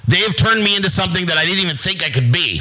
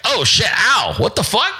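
mock surprised yelp; 6 semitones higher than usual.

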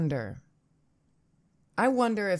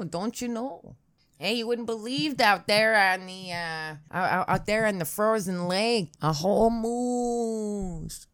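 hammed-up Midwestern accent, much higher, the moose drawn out slow; 4 semitones higher than usual. Shown as a demonstration. Showing off the Midwestern accent of his tired voice, hammed-up Midwestern. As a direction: lay the Midwestern accent on thick like a north woods storyteller. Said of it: "It's very Midwestern".

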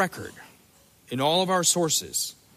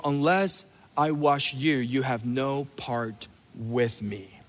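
solemn; 5 semitones lower, 4 dB quieter, slower than usual. Shown as a demonstration. Solemn Jesus quote.

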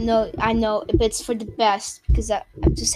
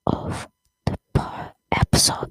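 whisper. Acted. Whispered correction.

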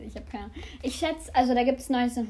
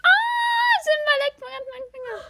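cooing at cat; 13 semitones higher, 5 dB louder than usual. Real, not acted. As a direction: gush over the cat, very high.

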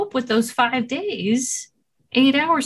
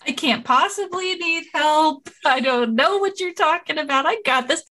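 higher-pitched, mock-insistent denial; mock self-sufficiency; 5 semitones higher than usual. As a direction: playfully imitate someone refusing all help. Mocks refusing to need help.

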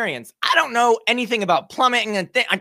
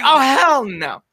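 slow defiant refusal; 5 semitones higher, 4 dB louder, slower than usual. Retold or imitated. Refusing the workers' excuse.

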